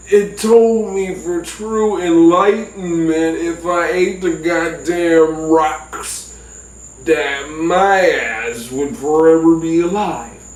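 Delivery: slow, drawn-out words; dazed explanation. Retold or imitated. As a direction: explain very slowly, as if hallucinating. Dazed excuse for eating rocks.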